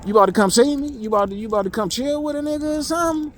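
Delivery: retelling his own excited reply to a call; happy excitement. Retold or imitated. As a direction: answer the call thrilled. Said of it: "I'm getting happy".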